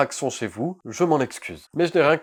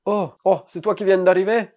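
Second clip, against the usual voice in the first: put-on higher, louder southern French accent; 8 semitones higher, 8 dB louder than usual. Villager greeting a newcomer, southern French accent. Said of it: "Je vais faire l'accent du sud".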